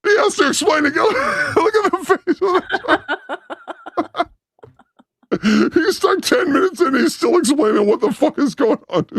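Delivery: high-pitched laughing disbelief; laughing so hard the words squeak out high; cracking up. Real, not acted. Cracking up at the clip.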